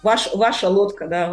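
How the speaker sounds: ordinary talk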